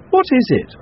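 high, loud startled question; a bystander peering at the rat; a man asking in loud surprise; curious man. Acted. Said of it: "said a man".

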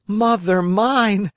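high, slow, terrified wooden puppet; terrified cry. A narrator's reading. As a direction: cry it out scared to death. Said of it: "cried the Marionette, scared to death".

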